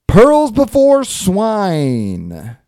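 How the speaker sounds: big, slow, high announcer voice; introducing the comic like a boxing contender; announcer intro. Acted. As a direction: boom the contender's name like a ring announcer. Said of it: "announcing the contender".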